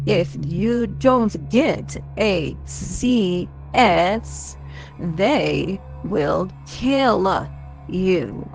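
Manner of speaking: slow, deliberate menace; said slowly. Acted. Elliot slowly spells out the threat to Dakota. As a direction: drag each word out slowly. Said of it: "So I'll say it slowly".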